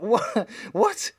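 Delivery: high, squeaky questioning what; baffled disbelief. Acted. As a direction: react with mock bewilderment, pitch shooting up. Baffled reaction to the accent.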